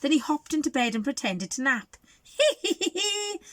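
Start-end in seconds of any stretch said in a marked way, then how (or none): 2.2-3.4 very high villain cackle; wicked snicker. Acted. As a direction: cackle gleefully like a sneaky wolf. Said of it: "he snarled".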